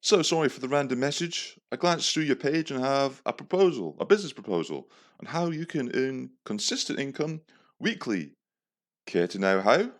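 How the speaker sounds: high-pitched, badly faked London trader; attempted London accent. London trader sends a business proposal, in a put-on London accent. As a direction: pitch it up into a hammy London sales patter. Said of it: "so this is how he replies".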